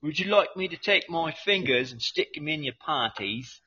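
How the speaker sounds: mock-polite British offer; hammed-up British pitchman; British accent. Continuing the joke commercial, put-on British accent. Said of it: "I should do it all fucking British".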